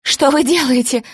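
high, loud frightened cry; screaming. A narrator's reading. Maya struck by the wife, screaming loud. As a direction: shriek it as the blows land. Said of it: "закричала Майя".

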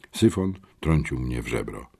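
ordinary delivery, nothing marked

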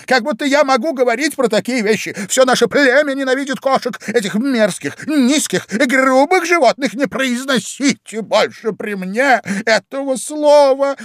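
high-pitched squeaky tirade; indignant mouse. A narrator's reading. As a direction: rant in outrage in a piping mouse voice. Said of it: "возмущённо воскликнула мышь". (возмущённо, писклявым мышиным голосом)

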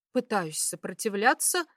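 ordinary delivery, nothing marked

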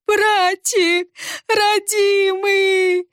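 high, drawn-out keening wail; pitiful wailing. A narrator's reading. The pockmarked girl wails over her brother, slow high keening. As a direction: howl it slowly in grief. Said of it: "жалостно завывала она".

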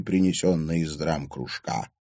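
ordinary delivery, nothing marked

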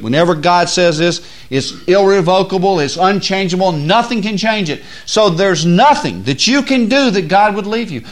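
loud, high, driving sermon voice; preaching at full force. Acted. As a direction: build the fire of the sermon.

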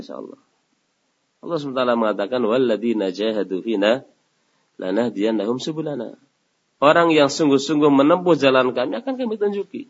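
ordinary delivery

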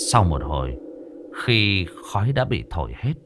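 ordinary delivery

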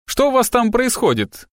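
high-pitched cranky call; quarrelsome yell. A narrator's reading. Grumpy neighbour calls up from the landing, quarrelsome. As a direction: call up crossly, pitched well up. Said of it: "выкрикнул с нижней площадки сварливый голос".

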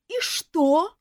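high, loud outraged retort; indignant elf. A narrator's reading. Elf indignantly retorts to the nurse, outraged. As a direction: snap back offended. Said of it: "возмутился эльф".

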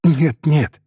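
lightly acted girl's exclamation; fervent cry. A narrator's reading. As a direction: protest it with feeling. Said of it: "вскричала Софичка".

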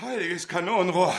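high sudden exclamation with a laugh; shocked outburst as the oven blows; startled cry. Acted. Jackson reacts as the oven bursts, startled.

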